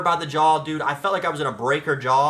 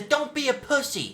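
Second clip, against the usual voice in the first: high, drawn-out retold outburst; slow, high mock cry; mock scream; 10 semitones higher than usual. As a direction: imitate her scream at a high pitch without full volume. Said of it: "scream it".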